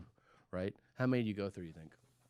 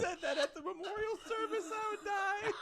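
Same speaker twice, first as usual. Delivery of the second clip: high, laughing shout. Real, not acted. Cracking up at the friend's eulogy joke, high laughing shout. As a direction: shout it through helpless laughter.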